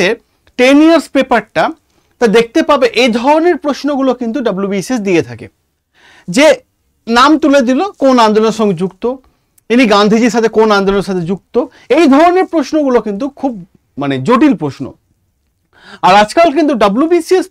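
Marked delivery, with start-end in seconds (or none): none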